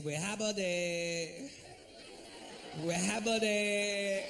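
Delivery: put-on a cashier struggling with the order in a Spanish accent; hammed-up Spanish-accented cashier; mock Spanish accent. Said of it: "He said".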